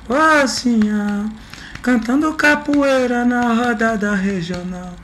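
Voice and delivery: slow high falsetto chorus; man doing a woman's voice. Shown as a demonstration. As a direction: sing the women's chorus up high, slowly. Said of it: "eu tô fazendo uma voz de mulher".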